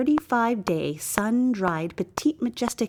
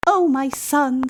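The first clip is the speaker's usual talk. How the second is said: put-on higher, slow and louder, a grand old queen; stately queen, fond and slow; queen's voice; 5 semitones higher, 4 dB louder, slower than usual. A narrator's reading. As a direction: draw it out regally. Queen answers her son.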